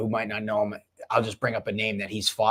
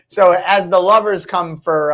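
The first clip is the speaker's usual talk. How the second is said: laughing; 6 semitones higher, 7 dB louder, slower than usual. Real, not acted. Host laughing reading comments.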